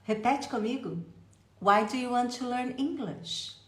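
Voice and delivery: ordinary delivery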